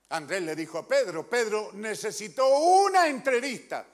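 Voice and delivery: loud fervent sermon delivery; preaching at full force. Real, not acted. Preacher retelling Andrew meeting Jesus, fervent and loud. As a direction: proclaim it to the whole church.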